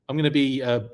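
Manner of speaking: usual speaking voice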